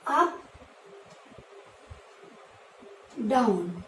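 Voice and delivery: Up rising high then down dropping low. Shown as a demonstration. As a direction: voice climbs on Up and falls on down. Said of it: "Like this".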